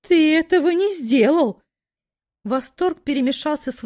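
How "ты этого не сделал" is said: high, loud astonished gasp; can't believe it, thrilled; amazed disbelief. Acted. Daughter amazed at father's deed. (изумлённо, с восторгом)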